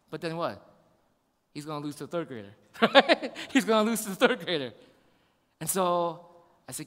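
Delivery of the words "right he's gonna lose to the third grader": quick punchline through a laugh. Real, not acted. Preacher joking about son losing, amused laughter. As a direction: say it while chuckling.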